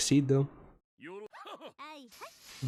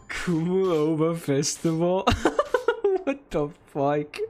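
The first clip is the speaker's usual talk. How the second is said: slow, laughing disbelief; incredulous laughing; 4 semitones higher, slower than usual. Real, not acted. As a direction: crack up at being fooled, dragging the words out.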